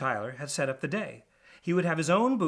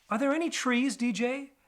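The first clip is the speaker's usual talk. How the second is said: high, hopeful bird voice; hooded falcon asking; 11 semitones higher than usual. Acted. Wendover asks DJ if there are trees, high and hopeful. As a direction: ask gently for trees.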